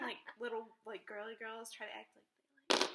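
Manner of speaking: usual speaking voice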